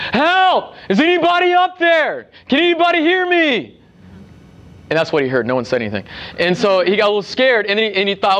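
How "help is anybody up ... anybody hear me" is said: high desperate yell for help from a cliff; shouting for help. Retold or imitated. Man dangling from a cliff yells up for help. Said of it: "he started shouting".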